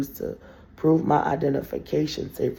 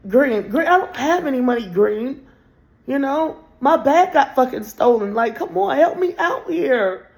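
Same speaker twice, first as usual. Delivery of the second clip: pleading shout; 8 semitones higher, 7 dB louder than usual. Retold or imitated. Retold begging the cashier.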